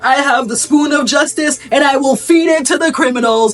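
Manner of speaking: mock pompous oratory; grand speech. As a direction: proclaim it like a self-important official. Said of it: "he gives a beautiful speech".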